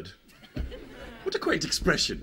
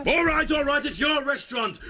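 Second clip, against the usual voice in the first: raised higher, conceding; louder, giving in; 10 semitones higher than usual. Real, not acted. Told to say it louder, he concedes it higher. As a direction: say it up, fed up. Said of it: "Louder. Say it louder".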